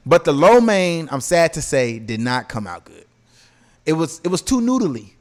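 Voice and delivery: usual speaking voice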